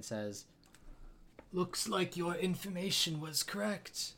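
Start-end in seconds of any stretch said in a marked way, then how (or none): 1.5-4.1 a higher, put-on voice for the village elder; cold and smug, a pitched-up elder voice. The Elder gloats to the exiled hero, higher and cold.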